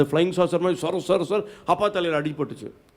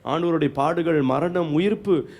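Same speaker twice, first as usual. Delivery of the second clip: ordinary talk, nothing marked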